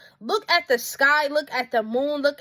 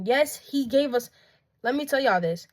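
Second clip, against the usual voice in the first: ordinary talk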